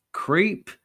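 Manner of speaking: high sharp spat word; high spitting insult. Acted. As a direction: spit it out with disgust. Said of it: "he spat".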